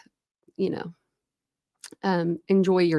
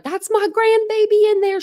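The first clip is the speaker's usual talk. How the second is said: excited mom; 5 dB louder than usual. Retold or imitated. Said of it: "my mom was like".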